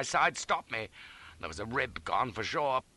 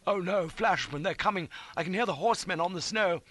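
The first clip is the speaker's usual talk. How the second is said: high, urgent dread; anxious raised pitch; alarmed; 8 semitones higher than usual. A narrator's reading. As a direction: warn of approaching danger. Companion hears pursuers coming.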